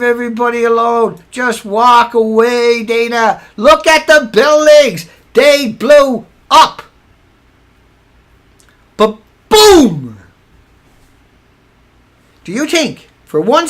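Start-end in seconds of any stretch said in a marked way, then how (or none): 3.6-6.8 slow, louder, each word spelled out; slow exasperated stress; slow emphatic insistence. Real, not acted. 9.0-10.2 a loud boom; mock explosion sound; louder mock explosion boom. Imitating explosion.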